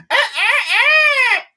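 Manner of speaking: very high crowing cry; imitated rooster crow. Imitating the rooster at four in the morning, crowing.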